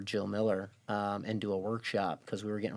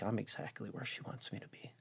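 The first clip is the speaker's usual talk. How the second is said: unvoiced awed whisper; 12 dB quieter than usual. Telling himself he's where grandmother wanted, whispered. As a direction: breathe the realization to yourself. Sudden realization told to self.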